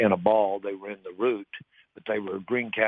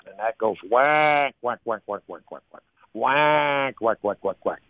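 hail call; 2 semitones higher, 4 dB louder than usual. Shown as a demonstration. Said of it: "the hail call".

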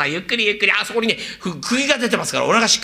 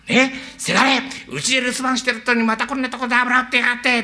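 put-on a scolding old father, pitched higher; the father's voice; 6 semitones higher than usual. Zen imitating the father scolding his son. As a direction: catch your son slacking off and scold him. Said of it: "あなたのお父っつぁん".

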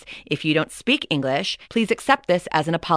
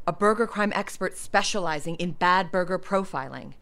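normal talking voice

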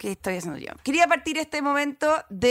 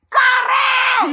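sudden high, very loud yell; full-voiced drawn-out shout, pitched far up; shouted warning; 8 dB louder than usual. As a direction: scream it like a crewman warning of danger. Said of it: "me grita de repente un productor".

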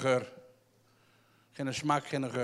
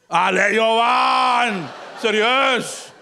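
high, drawn-out shout of disbelief; hammed-up "come on" protest; mock exasperation; 4 semitones higher, 3 dB louder, much slower than usual. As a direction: call him out, stretching the name. Mock exasperation with audience member.

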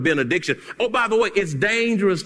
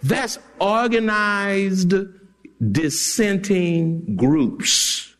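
slow definition; much slower than usual. Shown as a demonstration. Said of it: "defining slowly".

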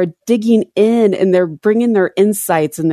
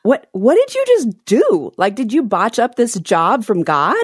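high, incredulous complaint; indignant Israelites; 5 semitones higher than usual. Retold or imitated. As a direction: demand an answer, disbelieving. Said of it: "And they're like".

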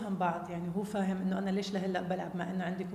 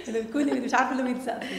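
laughing while talking; 4 semitones higher, 7 dB louder than usual. Real, not acted. Joking about cheering for both.

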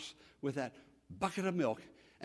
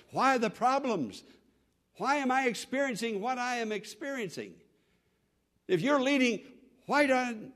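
complaining prayer; 6 semitones higher than usual. Acted. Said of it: "You may think".

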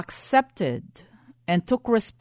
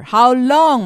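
preaching cry; 3 semitones higher, 8 dB louder than usual. Real, not acted. Preacher repeats the appeal.

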